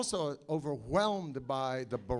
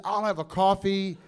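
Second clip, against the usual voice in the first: Lloyd ordering; 8 semitones higher, 8 dB louder than usual. Retold or imitated.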